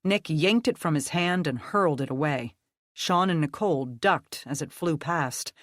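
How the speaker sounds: plain reading voice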